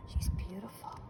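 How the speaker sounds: awed whisper. Acted. Awed aside to listeners.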